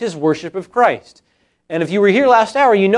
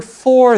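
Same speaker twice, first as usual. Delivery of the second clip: "for" lifted high and loud; stress on for; 5 semitones higher, 4 dB louder than usual. Shown as a demonstration. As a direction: point out the little word "for" to the congregation, leaning on it hard. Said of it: "notice that little word".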